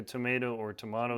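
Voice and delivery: first "tomato" as to-MAY-to, second "tomato" as to-MAH-to; contrasting pronunciations. Shown as a demonstration. Comparing the two sayings, "tomato" as to-MAY-to, then to-MAH-to. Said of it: "like a tomato or tomato thing".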